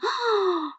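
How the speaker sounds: high, sharp mock gasp; hammed-up gasp of an offended mother; shocked gasp.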